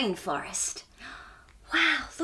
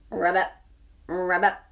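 frog croak; 6 semitones lower than usual. Acted. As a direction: lower croaked frog call.

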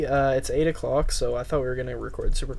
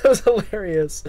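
laughing; 7 semitones higher, 7 dB louder than usual. Real, not acted. Laughing at friend's death.

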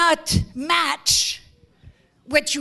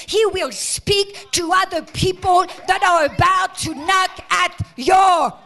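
preaching at full force; 3 semitones higher, 5 dB louder than usual. Real, not acted. Preacher promising the congregation help, full force. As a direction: preach it rising to the crowd.

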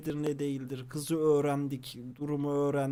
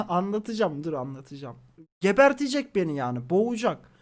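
usual speaking voice

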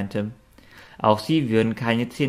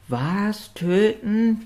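a much higher, startled yelp of alarm; startled bark; 11 semitones higher, much slower than usual. A narrator's reading. Noti hears the ghosts want to kill, yelping in fright. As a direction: jump up in pitch as if frightened. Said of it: "bellte Noti erschrocken".

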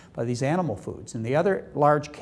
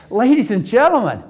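megaphone announcement; 7 semitones higher, 9 dB louder than usual. Pretend. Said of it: "got up on their megaphones and said".

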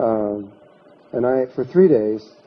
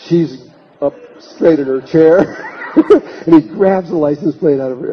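laughing hard; 5 semitones higher, 6 dB louder than usual. Real, not acted. Laughing at the climax of the psychic story. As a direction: crack up while picturing her sitting bolt upright.

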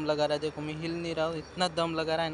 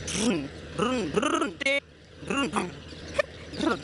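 goat noise imitation; 3 semitones higher, slower than usual.

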